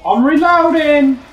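high, loud, drawn-out reload shout; shouted callout. Real, not acted. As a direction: yell it out to the team, stretched long. Calling out reload to teammates.

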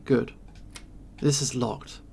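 ordinary talk

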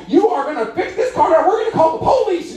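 very high mock-outraged squawk; women threatening him. Three women demand he fix the car, high hammed-up yelling. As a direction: screech threats like three angry sisters.

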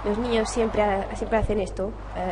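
ordinary delivery

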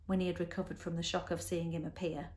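plain reading voice

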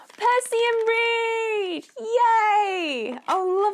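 high, loud, drawn-out cry of joy; thrilled shout. Real, not acted. Spotting a hair care brand in the box, thrilled shout. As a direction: squeal it out like a delighted fan.

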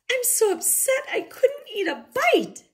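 upset wail. A narrator's reading. Mama Bear upset, crying.

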